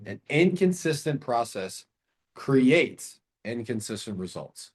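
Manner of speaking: slow and weighty; slow, deliberate. Shown as a demonstration. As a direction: spell it out slowly. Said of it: "write down that".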